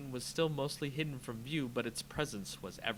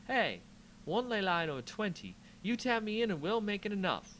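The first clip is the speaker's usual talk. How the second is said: bright, higher rallying voice; eager; 8 semitones higher than usual. Acted. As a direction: lift her spirits, voice climbing. Chandra rallies Nissa.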